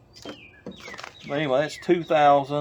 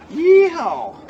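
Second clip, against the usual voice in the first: high, loud yeehaw holler; loud holler swooping down from high; cowboy whoop; 13 semitones higher, 9 dB louder than usual. Real, not acted. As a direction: whoop like a rodeo rider. Whoops while climbing out the window.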